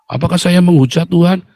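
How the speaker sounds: ordinary talk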